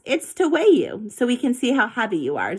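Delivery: ordinary delivery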